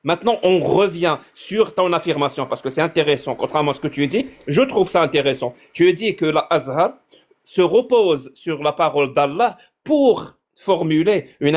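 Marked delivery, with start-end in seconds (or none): none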